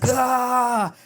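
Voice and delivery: sudden high mock scream "Ah"; hammed-up faraway shriek; imitating a distant scream. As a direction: yelp it like a far-off prisoner screaming. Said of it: "screaming in the distance".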